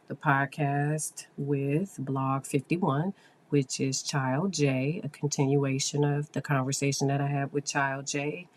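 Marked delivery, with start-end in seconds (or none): none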